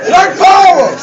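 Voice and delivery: high, loud rallying cry; slogan shout. Retold or imitated. Activist storms into the store to rally brothers, high shout.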